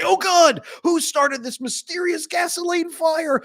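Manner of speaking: high, loud, slow cry of alarm; a hammed-up wail at the fire; mock panic. Acted panic of person in fire.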